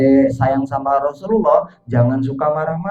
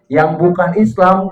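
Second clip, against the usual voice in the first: usual speaking voice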